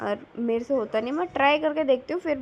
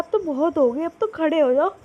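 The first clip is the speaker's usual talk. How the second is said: put-on higher, louder whine of a sleepy toddler; whiny sleepy toddler; 5 semitones higher, 6 dB louder than usual. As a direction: beg like a tired little boy who wants his milk. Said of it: "तनिक बोल रहा है". (नींद में रोता-सा ऊँचा बच्चा)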